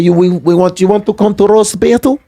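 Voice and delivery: fake Russian accent. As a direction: invite him in a mock Russian accent. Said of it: "that's a great fake Russian".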